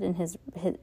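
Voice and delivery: plain reading voice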